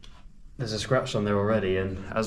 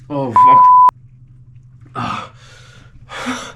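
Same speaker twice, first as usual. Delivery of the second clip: sudden loud disgusted yelp; shocked outburst; 16 dB louder, much slower than usual. Real, not acted. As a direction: recoil from a terrible stink. Hit by a foul stench.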